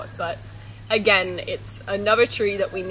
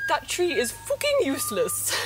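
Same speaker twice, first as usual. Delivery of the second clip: put-on opa's heavy Dutch accent, pitched up; 6 semitones higher than usual. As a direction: imitate a grandfather's thick Dutch accent. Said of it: "in his very heavy Dutch accent".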